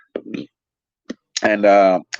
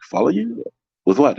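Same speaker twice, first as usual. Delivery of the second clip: a baffled new guy tossing the question back; incredulous retort; 6 semitones higher than usual. Retold or imitated. New guy questioning a cook's order, high and incredulous. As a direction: pitch jumps way up in disbelief on Follow you.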